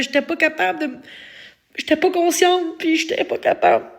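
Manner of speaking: voix aiguë, émue et tremblante; en pleurant. Real, not acted. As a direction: parle en retenant ses larmes. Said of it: "Je t'émotive". (voix pleine de larmes)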